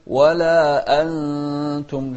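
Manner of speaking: أنتم chanted with a firm glottal start; clear hamza. Shown as a demonstration. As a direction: hold ولا, then strike the hamza of أنتم. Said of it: "الهمزة أيضًا".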